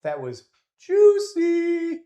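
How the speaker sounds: squeaky mock catchphrase, stretched on juicy; imitating Perry. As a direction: ham up a friend's catchphrase. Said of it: "as my friend and mentor Perry says".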